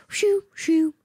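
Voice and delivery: high swishing shoo sounds; windshield wiper imitation. Driver showing wipers turning on by themselves, high swish noise.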